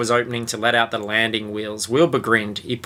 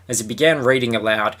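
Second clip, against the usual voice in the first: normal talking voice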